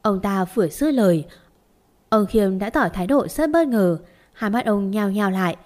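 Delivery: plain reading voice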